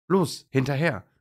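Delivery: high, loud rallying call. A narrator's reading. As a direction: call them to give chase. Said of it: "ruft Trixie Dackel".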